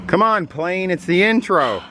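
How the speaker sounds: high-pitched plea to a noisy plane; real exasperation, voice lifted high; exasperated complaint. Real, not acted. Exasperated at plane interrupting the intro.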